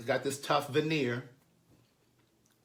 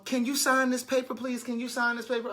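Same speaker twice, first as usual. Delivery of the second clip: put-on higher, pleading little girl voice; pleading schoolgirl; 7 semitones higher than usual. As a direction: beg like a scared student, pitched up. Students begging teacher to sign.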